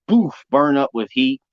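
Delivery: usual speaking voice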